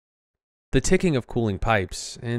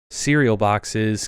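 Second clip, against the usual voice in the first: ordinary delivery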